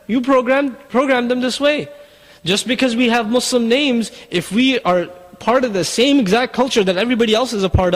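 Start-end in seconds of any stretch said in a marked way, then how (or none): none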